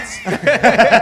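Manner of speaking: a big real laugh at his own joke; loud laugh. Real, not acted. Telling friends his Lagos answer, laughing out loud.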